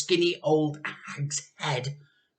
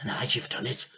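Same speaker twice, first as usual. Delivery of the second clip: plain reading voice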